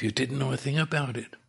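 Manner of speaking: normal talking voice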